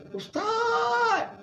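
jeritan tinggi dan nyaring menyebut Ustaz; retold scream. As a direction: tiru jeritan terkejut kawan jenazah. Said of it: "dia jerit". (kawan menjerit memanggil ustaz kerana terkejut, jeritan tiruan)

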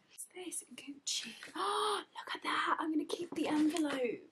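unvoiced whisper, hushed and amazed; awed whisper. Real, not acted. Unwrapping the gift to viewers, awed whisper. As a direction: whisper as if in awe. Hushed awe while unboxing.

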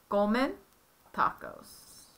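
"Comen tacos" said clearly, fading out at the end; teacher's model; Spanish model phrase. Shown as a demonstration. Parent models the they form. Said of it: "you would say".

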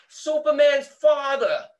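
a hammed-up teasing host, pointing at the guest; mock taunt. Host mocks Russell Crowe on air.

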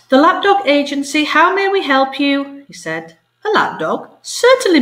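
high, lightly voiced parrot receptionist; polite phone voice. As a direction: play the eager receptionist. Cockatoo answers phone politely.

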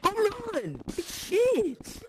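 high, sharp shout of dismay; excited shout. Real, not acted. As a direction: yelp it out in shock. Reacting to a home run.

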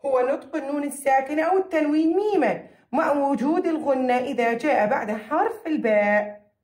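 normal talking voice

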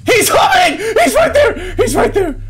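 very high, loud shrieks; real jump-scare panic; panicked screaming. Real, not acted. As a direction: scream it as if being chased. Monster chases him in game.

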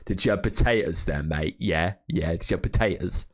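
put-on Cockney banter; Cockney accent. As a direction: tease an opponent in a London Cockney accent. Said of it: "You don't have to be all Cockney about it".

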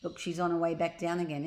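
ordinary delivery

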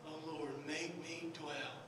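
soft, low, with long pauses; gentle, low-pitched preaching; hushed sermon close. Real, not acted. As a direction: bring it down to a quiet, prayerful murmur.

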